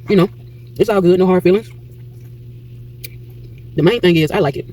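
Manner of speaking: talking without dentures; gummy speech, teeth out. Real, not acted. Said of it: "I didn't even put my teeth in".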